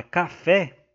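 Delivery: café with the final "fé" stressed; stress on last syllable. Shown as a demonstration. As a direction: say "café" slowly, leaning on "fé". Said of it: "a última sílaba é a tônica".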